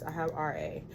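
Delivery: ordinary delivery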